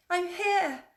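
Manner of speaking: high, loud call of "I'm here!"; loud shouting girl. Acted. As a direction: call out to the others in the passage. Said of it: "she shouted".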